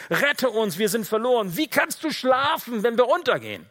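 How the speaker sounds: high, loud desperate plea, read aloud; cried out. A narrator's reading. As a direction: read it as the disciples crying for rescue. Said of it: "schrien sie". (laut und hoch vorgelesener Hilfeschrei)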